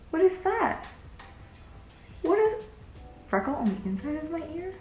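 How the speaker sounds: very high, rising startled questions; high-pitched shocked questioning; alarmed disbelief. Real, not acted. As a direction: squeak it out in baffled alarm. Startled by ear camera view.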